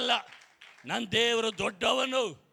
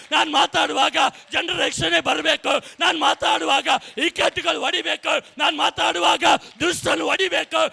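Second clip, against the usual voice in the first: loud, building preacher's cry, higher by the end; preaching at full force; 4 semitones higher, 7 dB louder than usual. Acted. Pastor rousing the congregation, shouted preaching. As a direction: proclaim it to the whole hall, rising.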